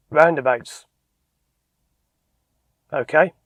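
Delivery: blunt ROUNDABOUTS, long silence, then OKAY; long pause before OKAY. Shown as a demonstration. Examiner waits for the instructor's reason, long pause before OKAY. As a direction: leave an awkward gap before OKAY. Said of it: "did you notice the pause".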